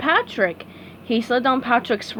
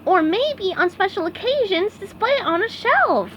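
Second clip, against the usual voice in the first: SpongeBob voice; 9 semitones higher than usual. A narrator's reading. SpongeBob explaining.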